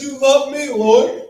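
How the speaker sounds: loud, high mock plea; mock complaint. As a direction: imitate someone wailing a question to God. Mimics a complainer to God.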